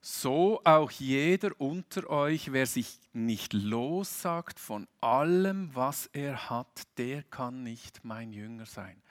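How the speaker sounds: slow, solemn Bible reading; measured preacher reading scripture. Acted. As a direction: read the verse slowly and weightily. Reads a Bible verse.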